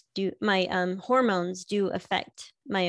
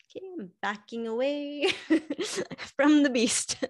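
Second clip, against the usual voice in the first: higher, laughing mock-cautious line; mock retreat; 7 semitones higher than usual. Husband backs away from his irritable wife, laughing mock fear. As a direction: back away in playful fear while laughing. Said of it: "he's learned to just be like".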